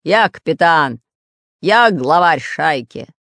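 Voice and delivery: loud, slow growl of a pirate boss. Acted. Pirate boss asserts his rank to the spy, growling. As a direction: growl each word with authority. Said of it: "рычал Буль-Буль".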